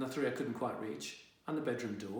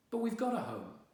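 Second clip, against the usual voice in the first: high protest; 10 semitones higher than usual. Acted. Boy protesting.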